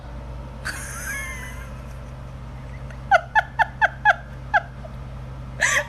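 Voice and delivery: long, high wheezing laugh; real uncontrollable laughing fit; helpless laughter. Real, not acted. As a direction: laugh until you are nearly crying. Cracking up at her story.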